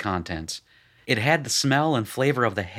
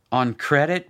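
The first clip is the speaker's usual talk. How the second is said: louder, rising query; puzzled question; 5 semitones higher, 5 dB louder than usual. A narrator's reading. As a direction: ask it with doubt. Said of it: "Rooster asked".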